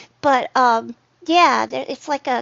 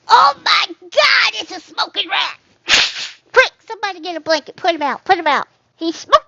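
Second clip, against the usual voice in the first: mock panic; 6 semitones higher, 6 dB louder than usual. Mock panic over smoking rat.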